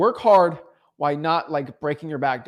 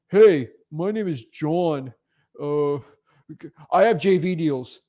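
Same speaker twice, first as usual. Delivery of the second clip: slow, dragged-out lame introduction; mock clueless poster; slower than usual. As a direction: drawl it like a clueless newbie. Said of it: "but don't be like".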